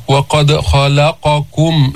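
slow وقد خلقكم, thick خ and ق, thin ل; deliberate وقد خلقكم, each letter's weight distinct; alternating heavy and light. Shown as a demonstration. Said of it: "لام مستفلة بعدين قاف مستعلية".

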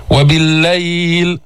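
slow tajweed, drawn-out vowel; Quran recitation. Shown as a demonstration. Teacher models where to pause, slow recitation. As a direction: stretch it and stop.

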